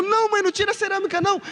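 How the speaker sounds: very high, fast, frantic plea; panicked begging. Retold or imitated. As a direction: beg her not to pull it out. Boy begging mother not to. (menino implorando em pânico, voz bem aguda e rápida)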